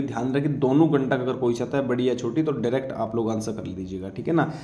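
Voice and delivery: ordinary delivery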